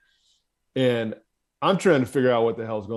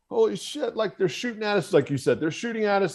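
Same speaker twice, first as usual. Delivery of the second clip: panicked pilots; 9 semitones higher than usual. Retold or imitated. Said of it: "I'm hearing the pilots, like, screaming".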